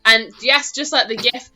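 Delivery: ordinary talk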